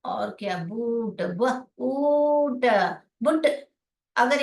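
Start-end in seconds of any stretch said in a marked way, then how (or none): 0.6-2.6 बूट, ब, ऊट with oo drawn out; long vowel blend. Shown as a demonstration. Teacher blending for students, long oo in बूट. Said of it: "ये बूट है". 3.2-3.5 बुट with a quick short oo; short vowel. Shown as a demonstration. Teacher showing the misreading, short oo in बुट. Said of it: "बुट हो जाएगा".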